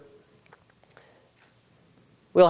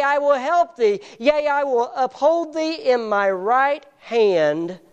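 higher, slow and stately, stretching each phrase; solemn scripture reading; 6 semitones higher, slower than usual. Acted. Preacher reading God's command to Joshua, slow and solemn. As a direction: proclaim the verse slowly from the pulpit. Preacher reads Isaiah aloud.

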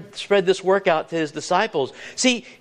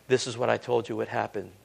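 soft, lower, gentle Lord; compassionate Lord; 8 semitones lower, 8 dB quieter than usual. Retold or imitated. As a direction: speak it tenderly to Peter. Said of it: "a eye of compassion and love".

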